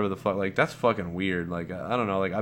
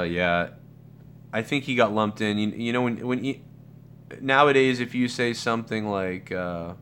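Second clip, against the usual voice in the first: usual speaking voice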